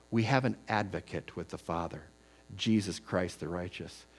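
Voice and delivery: gentle quiet quote; soft scripture quote. Acted. Quotes 1 John softly.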